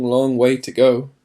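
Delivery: ordinary delivery, nothing marked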